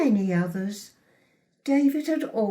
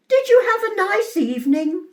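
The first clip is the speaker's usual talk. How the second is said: high, quick, raised call; 9 semitones higher, 6 dB louder, faster than usual. A narrator's reading. Mother to son from the kitchen, calling out. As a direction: call through to another room. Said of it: "I'd call out from the kitchen".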